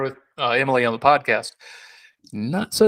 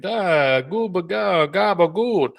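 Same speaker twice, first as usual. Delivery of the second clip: high mock Italian-American gabagool; Italian guy; 6 semitones higher, slower than usual. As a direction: ham up a Jersey Italian accent, pitched up. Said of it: "I'm an Italian guy all of a sudden".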